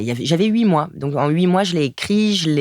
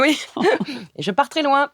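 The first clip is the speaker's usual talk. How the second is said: laughing; 11 semitones higher than usual. Real, not acted. Both laughing together.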